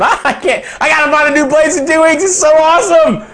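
can't stop laughing as you talk; real laughter. Real, not acted. Preacher to his congregation, laughing at his own trials. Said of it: "but I'm laughing".

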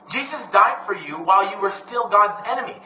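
ordinary delivery

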